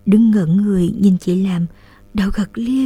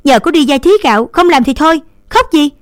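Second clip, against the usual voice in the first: higher, louder, fast snap; scolding; 7 semitones higher, 6 dB louder, faster than usual. A narrator's reading. Big sister snapping at her crying brother, angry. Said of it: "Đường quát em".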